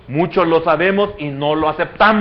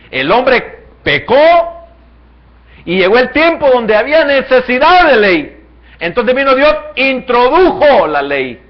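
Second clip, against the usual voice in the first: rising, louder sermon build; preaching at full force; 9 semitones higher, 9 dB louder, slower than usual. Acted. Preacher driving the point home to the congregation, loud and rising.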